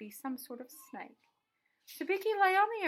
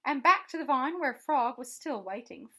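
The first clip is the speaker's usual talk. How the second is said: usual speaking voice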